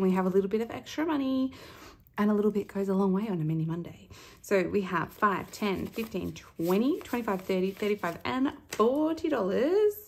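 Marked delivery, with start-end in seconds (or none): none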